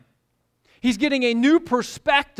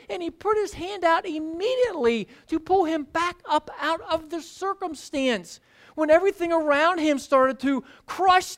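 impassioned sermon delivery; fervent preaching; 8 semitones higher than usual. Acted. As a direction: drive the point home to the congregation. Preaching at full force.

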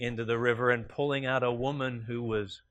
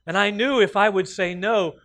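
higher, louder, eager pleading; a poor man pleads eagerly with the Lord, higher and louder; pleading excuse; 8 semitones higher, 9 dB louder than usual. Acted. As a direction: beg the Lord with a cheeky excuse. Man's excuse in joke punchline.

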